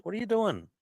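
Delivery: high cooing question to the cat. Real, not acted. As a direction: coo at the cat. Talking to the cat.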